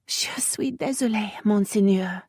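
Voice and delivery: nervous whispered French, sniffing; frightened little whisper; sniffling whisper. Acted. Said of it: "she sniffed".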